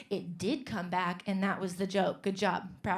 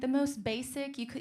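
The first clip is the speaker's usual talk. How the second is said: ordinary talk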